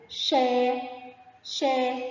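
"شا" stretched as a long shaa. Shown as a demonstration. Teacher showing pupils alef lengthening, "شا" drawn out long. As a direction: hold the aa in "شا" on a level note. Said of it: "صوت طويل مع مد بالألف".

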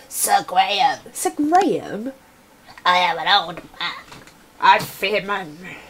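hammed-up sighing old monarch; dramatic reading of the king. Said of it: "I'm trying to be dramatic".

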